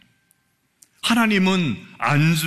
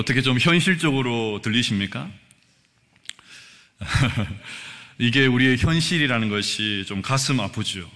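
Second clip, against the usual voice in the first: ordinary talk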